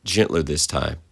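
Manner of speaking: ordinary delivery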